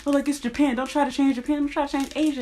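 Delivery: sarcastic imitation of people defending Japan; mocking defenders. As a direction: parody them as naive and preachy. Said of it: "trying to defend that shit and be like".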